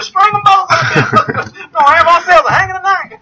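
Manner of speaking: high, laughing caricature of a townsperson; drawn-out, very high mock rabble-rouser; mock Southern local. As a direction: holler it as a hammed-up Southerner. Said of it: "like you said".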